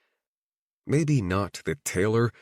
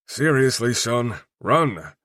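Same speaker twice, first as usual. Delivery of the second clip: urgent; 3 semitones higher, 4 dB louder, slower than usual. A narrator's reading. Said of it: "Hunter says with more urgency".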